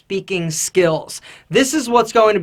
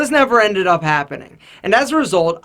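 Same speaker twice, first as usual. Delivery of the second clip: ordinary talk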